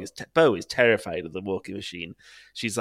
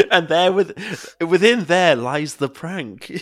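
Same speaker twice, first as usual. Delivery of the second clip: mock grand; 6 semitones higher, 5 dB louder, much slower than usual. Dramatic mock pronouncement.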